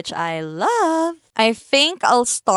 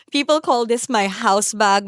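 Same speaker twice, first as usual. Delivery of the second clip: ordinary talk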